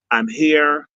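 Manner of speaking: normal talking voice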